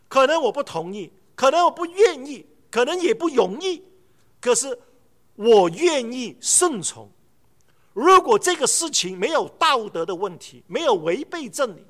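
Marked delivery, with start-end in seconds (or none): 5.3-7.1 我愿意顺从 said very slowly; preacher declaring resolve slowly; slow emphasis. Acted. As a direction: lay weight on 我愿意顺从. Climax of obedience point.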